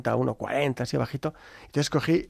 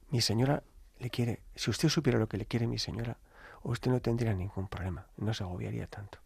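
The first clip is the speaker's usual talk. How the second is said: low, soft, tender assurance; very sweet voice; 3 semitones lower, 8 dB quieter than usual. Retold or imitated. Delivery man consoling worried priest, sweet and quiet. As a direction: soothe him quietly, fading softer at the end. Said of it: "Con una voz muy dulce".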